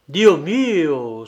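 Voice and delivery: loud cry of alarm; exclaiming. A narrator's reading. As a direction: exclaim it loudly, like a startled cardinal. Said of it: "s'écria le cardinal". (exclamation vive, à voix forte)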